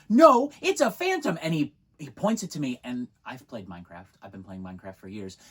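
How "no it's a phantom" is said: put-on high, loud stubborn kid; very high loud child insisting; insistent little boy. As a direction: dig in, you know you're right. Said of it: "he goes".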